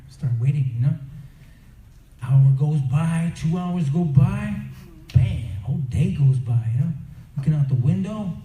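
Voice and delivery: ordinary talk, nothing marked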